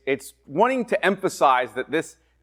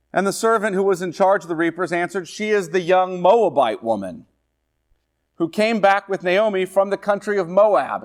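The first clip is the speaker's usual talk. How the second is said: scripture reading; 5 semitones higher, 3 dB louder than usual. Acted. Preacher reading Ruth aloud to the class. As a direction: read the verse out to the class.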